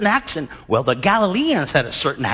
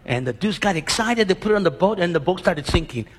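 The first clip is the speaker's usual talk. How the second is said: ordinary talk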